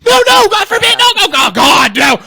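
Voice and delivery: high, loud wailing protest; shouted, whimpering coward impression; mock panic. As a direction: backpedal in hammed-up tearful terror. Acts Katie backtracking in panic.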